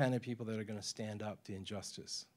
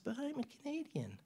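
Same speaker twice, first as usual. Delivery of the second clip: much higher, soft and timid; meek voice; 12 semitones higher, 8 dB quieter than usual. Retold or imitated. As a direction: plead it timidly. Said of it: "that's how she talks".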